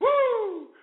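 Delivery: high excited woo; party whoop. Real, not acted. Celebrating capitalism.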